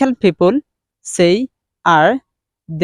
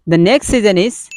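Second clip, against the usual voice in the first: ordinary talk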